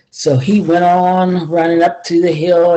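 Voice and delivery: ordinary delivery, nothing marked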